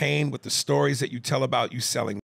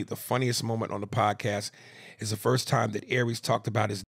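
normal talking voice

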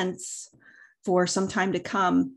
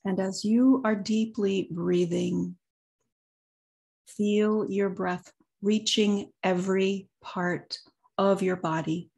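guided meditation. Acted. Leads a meditation, long pauses.

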